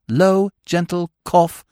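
ordinary delivery, nothing marked

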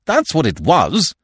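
loud, high, decisive; sharp, emphatic. A narrator's reading. Stoner slamming the table as he insists, sharp and emphatic. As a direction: drive it home forcefully. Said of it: "bringing down his hand with a slam".

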